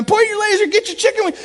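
high, loud shouts from behind the plate; shouted coaching cries; coach yelling. Retold or imitated. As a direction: bellow it like a Little League coach. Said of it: "I'm yelling all this stuff".

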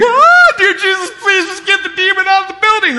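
high, loud, frantic hammed-up prayer; comic panicked shout to God; mock panicked prayer. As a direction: pray like you're terrified of the demon next door. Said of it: "And I was like".

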